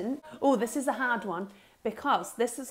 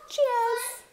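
bright, much higher Cheers!; cheery toast; 13 semitones higher than usual. Real, not acted. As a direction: raise your cup to a colleague. Toast with coffee.

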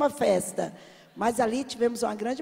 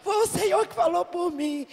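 crying out to God; 8 semitones higher, 4 dB louder than usual. Retold or imitated. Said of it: "Eu comecei a chorar".

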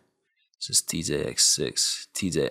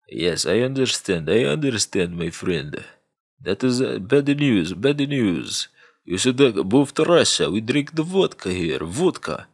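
slightly higher, put-on Russian accent; mock Russian accent; 3 semitones higher than usual. Maxime answering the hacker, in a mock Russian accent. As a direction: sympathize like a Russian fixer. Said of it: "hit him up like".

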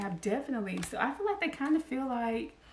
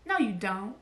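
usual speaking voice

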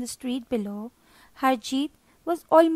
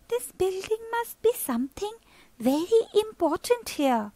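put-on boy thinking aloud; 8 semitones higher than usual. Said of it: "Harjeet thought to himself".

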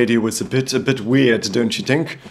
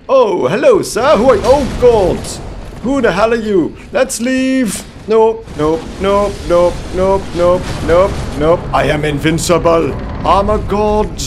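panicked; 5 semitones higher, 4 dB louder than usual. Real, not acted. Startled by a strange knight.

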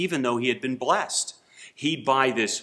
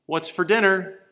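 higher, louder call to an unhearing wife; 7 semitones higher, 6 dB louder than usual. Retold or imitated. Husband calling closer to his wife, louder. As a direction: call out a bit more insistently. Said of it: "And he said".

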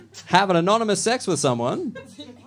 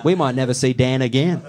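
mock mates gossiping; 5 semitones lower than usual. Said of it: "Being like".

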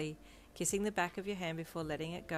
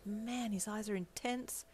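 slow, pitched-up breathless murmur; breathless awe; 4 semitones higher, slower than usual. A narrator's reading. You to Deku after the rival leaves, breathless. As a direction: breathe it out, awed. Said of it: "you said breathlessly".

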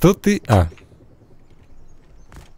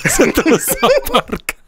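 laughing; 11 semitones higher than usual. Real, not acted. Смех над игрой.

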